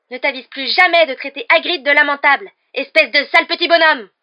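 loud, high-pitched angry shout; furious outburst. Acted. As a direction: scold him at full voice, outraged. Hermione slaps and scolds Malfoy.

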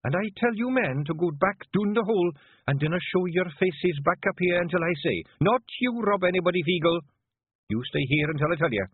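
high, icy Scots authority; cold, sharp command. A narrator's reading. Leader dismissing the men but keeping her husband, coldly. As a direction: order them away, cutting and hard. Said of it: "hard, cold, sharp".

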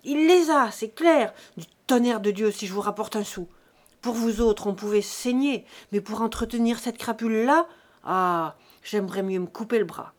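loud, furious outburst; explode, then keep railing bitterly; enraged peasant tirade; bursting with fury. A narrator's reading. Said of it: "il éclata de fureur".

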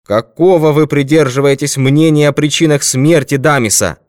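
high, loud interrogation; shouted demand. A narrator's reading. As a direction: press for an answer at a shout. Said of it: "крикнул он".

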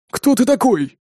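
high-pitched indignant demand. A narrator's reading. Terrorist challenging the intruder, indignant. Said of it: "возмутился один из террористов".

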